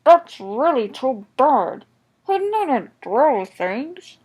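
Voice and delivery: loud, slow, muffled indignation; indignant through a covering. A narrator's reading. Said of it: "in a muffled voice".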